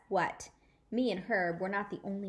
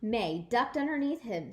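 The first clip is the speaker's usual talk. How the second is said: plain reading voice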